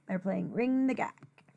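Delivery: usual speaking voice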